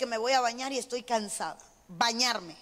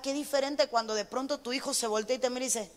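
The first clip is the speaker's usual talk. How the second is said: ordinary talk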